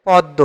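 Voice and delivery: পদ্ম said as "pod-do"; model pronunciation. Shown as a demonstration. Teacher gives the answer for পদ্ম, modelling it as "pod-do". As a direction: silent m, doubled d in পদ্ম. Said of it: "পদ্ম শব্দের সঠিক উচ্চারণ হবে".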